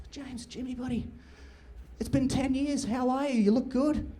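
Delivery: sweet on the surface, menace beneath; fake friendly. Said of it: "I'm gonna walk up to James Payton".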